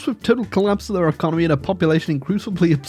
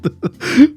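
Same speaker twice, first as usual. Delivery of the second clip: laugh; 12 semitones higher, 5 dB louder than usual. Real, not acted. Laughs at France isolated.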